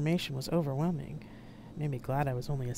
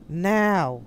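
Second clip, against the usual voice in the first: loud, raised one-word command; barked order; 4 semitones higher, 9 dB louder than usual. A narrator's reading. Aide orders everyone out when no one moves, barked. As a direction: bark it out sharply. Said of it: "I barked".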